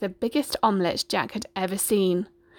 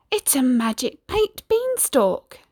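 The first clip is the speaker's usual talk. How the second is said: whispered; 5 semitones higher, slower than usual. A narrator's reading. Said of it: "Jack whispered to Bella".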